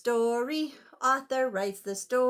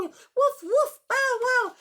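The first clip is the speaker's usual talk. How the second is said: high loud puppy barks; excited barking; 12 semitones higher, 7 dB louder than usual. Acted. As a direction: bark like frantic puppies. Dogs bark in storm.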